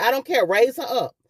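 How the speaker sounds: insisting the nephew lift her mother despite his objection; forceful retold order. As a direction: override the nephew's protest. Retelling urgent commands in emergency.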